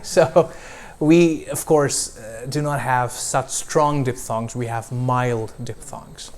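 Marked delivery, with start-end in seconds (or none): none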